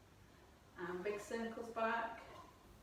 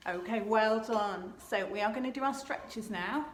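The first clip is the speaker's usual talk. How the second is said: loud praise; 9 dB louder than usual. Real, not acted. Praising class after warm-up.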